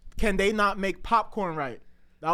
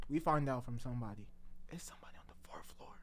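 hushed; 8 semitones lower, 13 dB quieter, faster than usual. Acted. Building suspense about the culprit.

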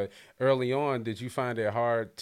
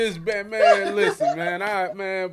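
loud, higher-pitched playful protest; mock outrage; 5 semitones higher, 7 dB louder than usual. Host playfully defends guest from schoolyard teasing, mock outrage. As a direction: act indignant on a bullied kid's behalf.

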